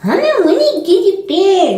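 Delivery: imitating the daughter mocking mom; sassy toddler voice. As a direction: talk back like a sassy little girl. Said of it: "she'd be looking at me like".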